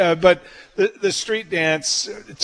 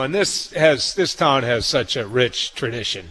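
ordinary talk, nothing marked

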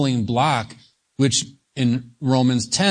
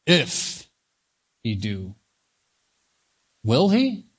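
doubtful questioning; slower than usual. Acted. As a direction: stop and question it, piece by piece. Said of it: "And you would say".

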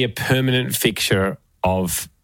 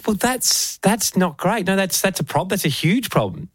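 incredulous; 8 semitones higher than usual. Retold or imitated. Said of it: "And I said".

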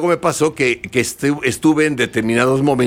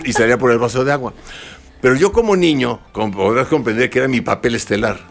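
ordinary delivery

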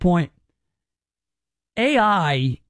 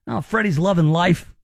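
ordinary talk, nothing marked

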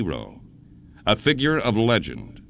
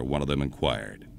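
plain reading voice

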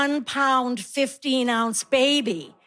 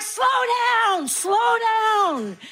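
exasperated mom calling slow down; mock yell at kids; 9 semitones higher, much slower than usual. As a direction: shout after running toddlers. Yelling at kids after bath.

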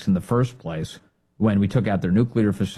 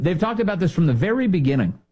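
ordinary talk, nothing marked

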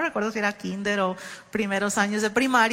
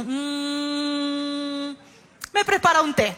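niño pidiendo té; 3 semitones higher, much slower than usual. Retold or imitated. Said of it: "Dijo lo mismo tres veces".

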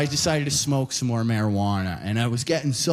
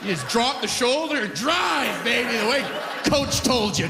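coach voice; 9 semitones higher than usual. Retold or imitated. Pumping up toddler like a coach.

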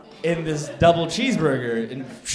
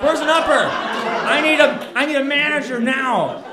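a very high, much louder yell, slow on the last words; entitled customer yell; 10 semitones higher, 8 dB louder, slower than usual. Retold or imitated. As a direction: shout like the guy demanding the manager. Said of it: "I become that guy".

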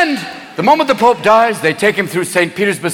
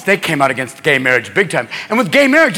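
normal talking voice

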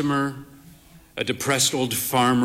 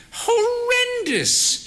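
much higher, drawn-out Horrendous; long-suffering old lady; slower than usual. Acted. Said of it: "she said".

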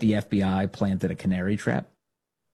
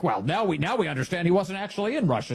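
mock reporter; 8 semitones higher than usual. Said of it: "he has been like".

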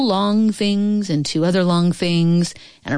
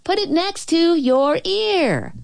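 put-on slow, high girl's call; high, drawn-out calling; shouting; 10 semitones higher, slower than usual. A narrator's reading. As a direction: holler instructions from far away. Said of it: "the little girl's shout came again".

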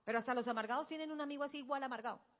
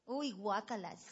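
mock girl disgust; 3 semitones lower, 5 dB quieter than usual.

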